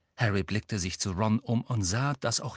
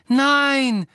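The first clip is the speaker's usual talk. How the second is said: high, loud scream of protest; shrill-pitched cry of refusal; 9 dB louder than usual. A narrator's reading. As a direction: scream it out in horror at full pitch. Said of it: "schrie Hermine".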